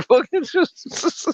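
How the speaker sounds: high, giggling through the words; laughing. Real, not acted. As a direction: break into laughter mid-word. Cracking up at the story.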